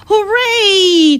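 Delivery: high, sustained storybook cheer; long ringing hooray. A narrator's reading. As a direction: cheer with the pitch way up, without shouting. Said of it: "cheered Keisha".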